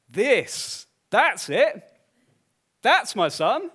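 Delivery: loud and high, the Father proclaiming; booming proclamation. Acted. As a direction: announce it with full force like a voice from heaven. Preacher voicing the Father's declaration.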